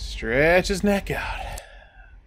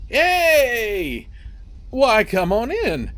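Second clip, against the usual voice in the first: put-on jolly old grandpa, higher and hearty; friendly grandpa; 8 semitones higher, slower than usual. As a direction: greet the kid like a cheerful old man. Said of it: "Grandpa seemed friendly".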